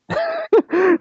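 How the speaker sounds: high burst of laughter; laughing. Real, not acted. Storyteller relishes telling how they quit, laughing. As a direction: laugh out high, really tickled. Laughs after retelling quitting.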